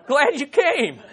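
loud, high, through laughter; laughing. Real, not acted. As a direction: push on while cracking up. Laughing at an awkward moment in church.

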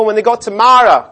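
ordinary delivery

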